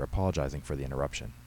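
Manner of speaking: plain reading voice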